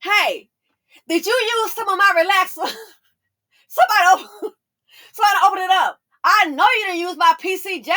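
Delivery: high, loud playful accusation; mock accusation. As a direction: accuse him in jest, laughing. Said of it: "and I'm like".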